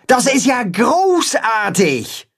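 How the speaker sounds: high, slow, delighted shout; a character shouting with joy at the news; thrilled shout. Acted. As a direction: cheer it out. Holstein thrilled by the party.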